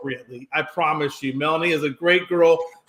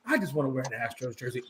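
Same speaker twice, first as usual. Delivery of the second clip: put-on small, low, quick kid's plea; softer, lower, faster, as a child asking; imitating a child; 7 semitones lower, 11 dB quieter, faster than usual. Uncle voicing a niece asking for a jersey, meek. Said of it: "and they say".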